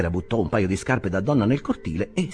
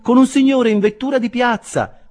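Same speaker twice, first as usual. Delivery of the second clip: put-on high, loud servant girl calling down; a maid announcing from the balcony; maid's call; 9 dB louder than usual. A narrator's reading. Maid voiced. (cameriera che chiama dall'alto)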